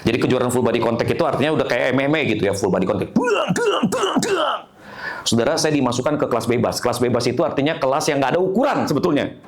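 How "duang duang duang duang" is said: high-pitched booming hit noises; imitated fight blows. Preacher shows full body contact karate, high imitated impact sounds. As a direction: voice the thuds of blows landing.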